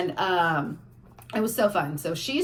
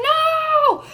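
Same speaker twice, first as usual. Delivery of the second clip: much higher, louder cry of no; retold scream; 7 dB louder than usual. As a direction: shriek it in alarm. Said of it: "they're screaming".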